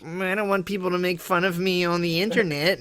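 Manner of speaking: lightly mock a fretful complainer; mock whiny worry. Mocking people who fear being laughed at online, in a put-on whine. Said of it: "And you're just like".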